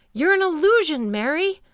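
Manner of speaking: loud, high, panicked cry; terrified shout. A narrator's reading. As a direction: scream it at a phantom. Chris raving in madness.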